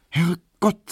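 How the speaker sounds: high, startled Herrgott; mild surprise. Acted. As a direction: exclaim at the late hour. Emma startled by the time.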